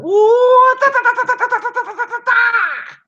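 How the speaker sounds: high whooping shout, then rapid "ta ta ta"; whooping punch flurry. Acted. Showing off his fist of the North Star, whooping punch flurry. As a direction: scream out a cartoon punch combo.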